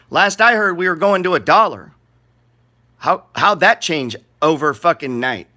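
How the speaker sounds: loud, high, disbelieving; incredulous rant. Real, not acted. Vlogger ranting at viewers about Doge, loud and incredulous. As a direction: call it out in mock outrage.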